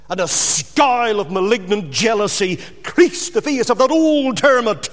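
ordinary talk, nothing marked

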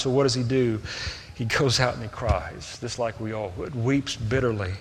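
usual speaking voice

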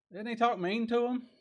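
high-pitched teasing question; coaxing high question to the church; rhetorical rise. Real, not acted. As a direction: lift the question way up to the congregation.

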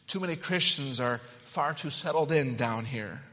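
normal talking voice

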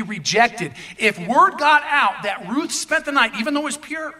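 forceful pulpit delivery, pounding key words; impassioned preaching. Real, not acted. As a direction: drive home the indignant judgment to the congregation. Preaches emphatically on the double standard.

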